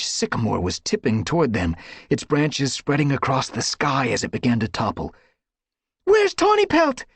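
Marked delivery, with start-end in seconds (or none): 6.0-7.0 high, loud anxious cry. A narrator's reading. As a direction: call out in alarm. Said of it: "she cried".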